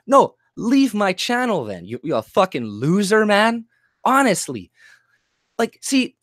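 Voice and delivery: ordinary delivery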